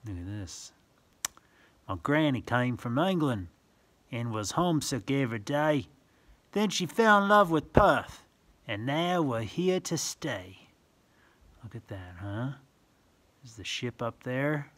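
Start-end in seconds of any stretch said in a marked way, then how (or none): 1.9-10.7 sing-song rhyming read-aloud; reading verse to children. Acted. Reading a picture book to a child, sing-song. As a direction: lilt the rhyme for little listeners.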